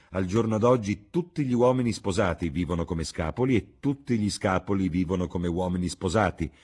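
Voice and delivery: animated hostess. Acted. Hostess lecturing the lord on married men, animated.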